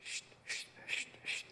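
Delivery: hushed, repeated shushing noise; quiet, voiceless scuffling sound; imitated rustling. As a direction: make soft creature noises outside a tent. Imitates the sound outside the tent.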